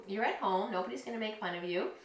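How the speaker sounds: normal talking voice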